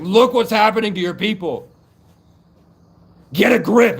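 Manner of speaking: loud, high-pitched exhortation; urgent rallying shout. Retold or imitated. Ancestor showing castle people the burning village, shouting urgently. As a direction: yell it to rouse them. Acts out giant rallying the Irish.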